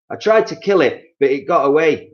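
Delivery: high, loud mock-alarmed husband; Mister Twit. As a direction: spook her, high and loud. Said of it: "Mister Twit said".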